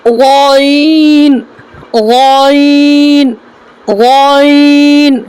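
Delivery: غین held very long from the back of the throat; slow, deliberate recitation of غین; drawn-out letter demo. Shown as a demonstration. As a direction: stretch each غین slowly like a teacher. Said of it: "تو غین کیسے نکالیں گے".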